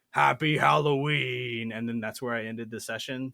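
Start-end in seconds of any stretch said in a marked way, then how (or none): 0.1-1.7 slow, drawn-out spooky farewell; a hint of a hammy villain sign-off; Death Knight. Death Knight bidding the goblins farewell on the porch, slow and mock-spooky. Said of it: "the Death Knight appears on the porch going".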